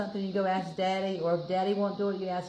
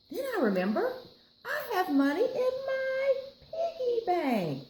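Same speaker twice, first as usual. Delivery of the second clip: high, excited realization; sudden bright idea; 4 dB louder than usual. Acted. Nancy remembering her piggy bank, much higher. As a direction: squeal with the idea.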